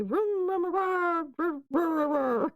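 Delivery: high copy of the mouse's nonsense call; imitated mouse squeak. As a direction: mimic the mouse's squeak, pitch sliding down. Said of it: "like he did?".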